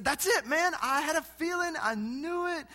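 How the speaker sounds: high, eager voice of the cashier; light up with high excitement; excited cashier. Retold or imitated. Cashier Jonas exclaiming to the preacher, high and excited. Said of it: "He said".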